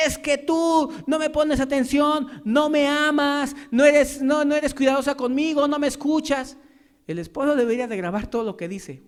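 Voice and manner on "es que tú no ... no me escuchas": complaining husband. Retold or imitated. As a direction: whine it like a neglected husband, dragging the words. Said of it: "el esposo no puede decir".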